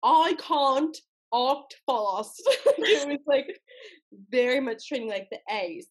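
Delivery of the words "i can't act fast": very slow British vowels, pitched high; exaggerated British long A's; British dialect drill. Shown as a demonstration. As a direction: stretch each word in tutorial British. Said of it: "voice to speak in British dialects".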